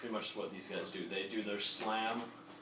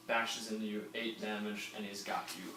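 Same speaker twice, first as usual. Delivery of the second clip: normal talking voice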